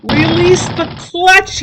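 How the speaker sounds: loud, high, slow yell. Acted. Donnie yelling at the learner driver, loud and slow. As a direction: shout the order over the engine. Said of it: "yelled Donnie".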